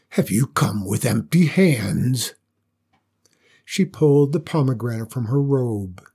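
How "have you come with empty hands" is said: dragon voice. Acted. The dragon keeps speaking.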